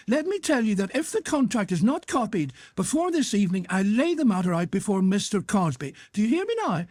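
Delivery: put-on high-pitched, irate boss with North of Ireland accent; indignant, high-pitched telling-off; angry scolding. A narrator's reading. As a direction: berate a slacking employee. Boss berates his clerk.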